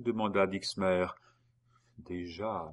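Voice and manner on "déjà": softer, barely voiced word; murmured. A narrator's reading. Geneviève murmuring to Dixmer as he asks if she is ready. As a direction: let it slip out faintly. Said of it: "murmura Geneviève".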